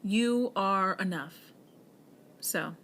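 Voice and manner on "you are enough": slow and loud closing line; the last words to the listener, slow and loud; emphatic affirmation. Real, not acted. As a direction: land it hard and slow. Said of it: "if there is anything that you take".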